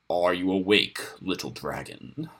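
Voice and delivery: low, rasping predator voice; grating wolf voice. A narrator's reading. Wolf leader rousing the captive dragon, low rasp. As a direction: scrape it out like talons on rock. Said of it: "The voice was talons on hard rock".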